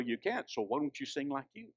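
ordinary talk, nothing marked

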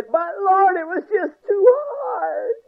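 high, drawn-out tearful whine; whining. Acted. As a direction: plead like a hammed-up whiner. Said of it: "Oh, you whiner".

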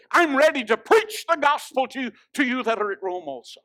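high, loud pulpit delivery; ringing sermon shout; full-force preaching. Real, not acted.

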